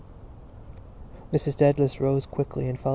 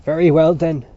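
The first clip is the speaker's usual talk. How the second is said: loud, rough challenge; hoarse shout; 2 semitones higher, 10 dB louder than usual. A narrator's reading. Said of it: "he shouted hoarsely".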